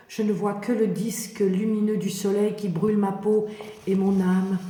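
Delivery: ordinary delivery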